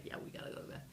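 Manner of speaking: hushed whisper to herself; whispered aside. Retold or imitated. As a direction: whisper it under your breath. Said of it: "I'm just like".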